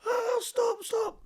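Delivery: high pleading stop, stop; imitated frightened pleading; begging cry. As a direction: beg him to stop, near crying. Victim begging in the house.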